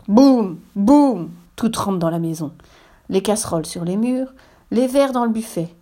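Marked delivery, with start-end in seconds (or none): 0.1-1.3 loud, booming "boum" blows; loud spoken thuds; heavy banging. A narrator's reading. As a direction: slam each sound out like a blow. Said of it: "Il cogne à grands coups".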